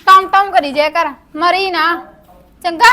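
loud, harsh telling-off; angry scolding. Acted. Sister-in-law turns on the girl once brothers leave, loud scolding. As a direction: scold her at full voice.

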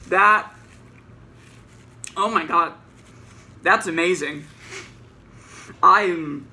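muffled behind arm over mouth; speaking with mouth full; talking while chewing. Real, not acted. Seen 1: Mid-bite, forearm held over mouth while talking; lowered by 6.8.

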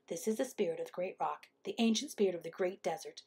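quick, soft telling; hushed warning. A narrator's reading. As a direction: warn quietly of an ancient spirit. Hummingbird warns of spirit.

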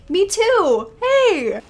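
high, loud outburst; loud exclamation. Real, not acted. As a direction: call out in emphatic agreement. Exclaims back at the game.

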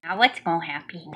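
ordinary delivery, nothing marked

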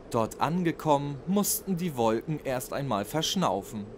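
ordinary delivery, nothing marked

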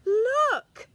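high, loud excited cry; excited shout. A narrator's reading. Children spot the snail trail, loud excited cry. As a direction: call out to point at it. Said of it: "say the children".

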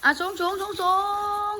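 high-pitched repeated call, last word stretched; calling a command. Real, not acted. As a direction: cue the elephant with a high, rising call. Commanding elephant to lift high. (ร้องสั่งเสียงสูง ลากคำท้าย)

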